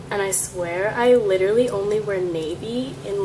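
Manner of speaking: ordinary talk, nothing marked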